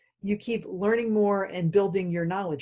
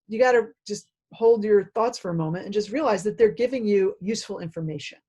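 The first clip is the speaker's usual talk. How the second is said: ordinary talk, nothing marked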